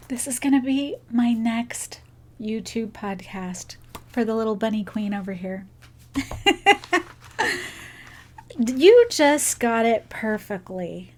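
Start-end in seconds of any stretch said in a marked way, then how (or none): none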